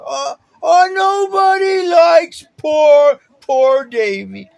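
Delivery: high, loud, slow fake sobbing; mock crybaby whining. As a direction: mimic a sneering elder mocking a crybaby. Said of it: "that's how he acted".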